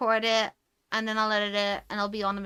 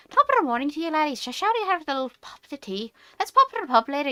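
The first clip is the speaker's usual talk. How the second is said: loud, higher mock southern Irish accent; 5 semitones higher, 4 dB louder, faster than usual. Mocking how people think all Irish talk, exaggerated southern Irish accent. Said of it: "talk like the fucking South".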